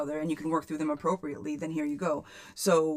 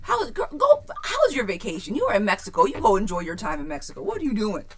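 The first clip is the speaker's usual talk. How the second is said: loud, high, thrilled greeting; excited shout; 5 semitones higher, 8 dB louder than usual. Real, not acted. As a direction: squeal hello to a friend back from vacation. Excited greeting to returning viewer.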